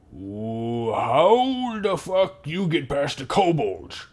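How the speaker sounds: slow, rising goblin disbelief; baffled goblin. Acted. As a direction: draw out the question in a high goblin voice. Goblin guard challenges the intruder.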